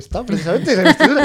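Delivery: breaking into loud laughter; laughing. Real, not acted. Recalling the Calvo Sotelo investiture to colleagues, laughing. Joking about coup during investiture.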